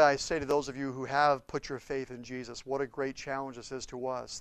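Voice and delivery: usual speaking voice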